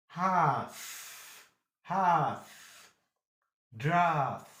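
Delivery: "Hearth", "Draft" with long held "ah". Shown as a demonstration. Teacher drills long A examples, drawn-out "Hearth". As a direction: stretch each vowel in "Hearth" and "Draft". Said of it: "gives you long ha".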